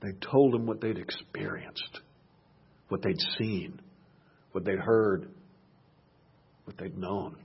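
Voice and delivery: soft unvoiced whisper. Preacher hushing the congregation, whispered testimony. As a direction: breathe it like a secret.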